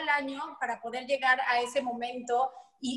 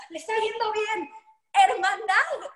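excited fan; 7 semitones higher, 4 dB louder, slower than usual. Retold or imitated. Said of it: "es como".

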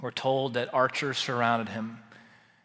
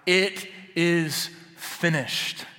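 slow, raised, drawn-out cry; crying out as Christ; 5 semitones higher, 4 dB louder, much slower than usual. Acted. Preacher voicing Jesus on the cross, slow ringing cry. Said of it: "he cried out".

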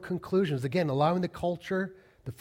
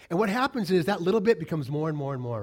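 ordinary delivery

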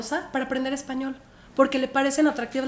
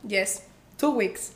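slow, soft, meek yes; timid answer; 5 semitones lower, 6 dB quieter, much slower than usual. Retold or imitated. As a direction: answer small and unsure. Ella responde nerviosa al agente. (respuesta tímida y lenta)

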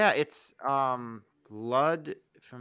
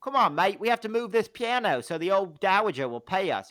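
Aussie bloke; 5 semitones higher than usual. Retold or imitated. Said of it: "Aussie and the Goomba".

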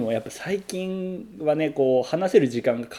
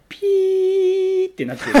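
bleep sound; 4 dB louder, slower than usual. Shown as a demonstration.

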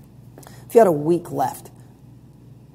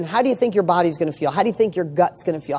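ordinary talk

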